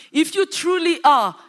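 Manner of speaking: loud, high taunting tempter; Satan tempting. Acted. Satan taunts Jesus, loud and high-pitched. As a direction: push hard, baiting Jesus loudly. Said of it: "Satan comes".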